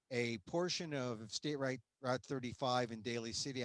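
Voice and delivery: ordinary talk, nothing marked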